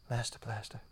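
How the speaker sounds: ordinary talk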